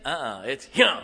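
sharp dog command. Acted. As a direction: give a curt command, punched at the end. Acted command to his dog.

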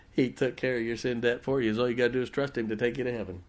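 ordinary delivery

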